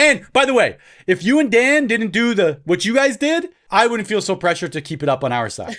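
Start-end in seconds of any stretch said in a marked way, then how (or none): 0.0-3.5 playful blame. Real, not acted. Teasing accusation with laugh.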